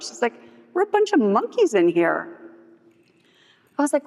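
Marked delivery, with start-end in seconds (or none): 0.7-2.4 higher, swooping dismissive voice; mocking scorn. Coworker telling dealer what they really are, scornful. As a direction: imitate a coworker belittling the whole place. Retold coworker belittles the job.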